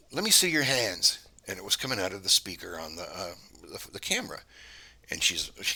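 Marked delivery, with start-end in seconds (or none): 0.1-1.2 lightly voiced child, pitched up; weird kid's voice. Unknown voice orders wife through camera, high weird kid. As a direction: imitate an eerie kid's demand, high. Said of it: "really weird kid's voice".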